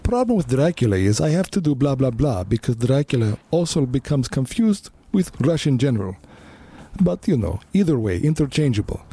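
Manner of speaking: high, hammy vampire voice; Dracula voice. Pretend. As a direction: ham up a cartoon Dracula. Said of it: "or to continue with do Dracula".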